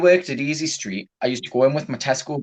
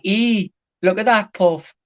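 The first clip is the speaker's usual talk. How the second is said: slow, higher Northern mum; lightly voiced blunt mother; imitating mum; 6 semitones higher, slower than usual. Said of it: "my mum was like".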